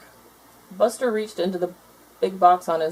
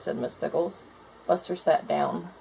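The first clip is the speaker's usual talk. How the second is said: plain reading voice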